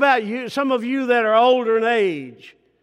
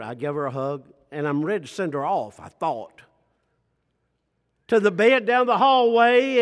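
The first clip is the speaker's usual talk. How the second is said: ordinary talk, nothing marked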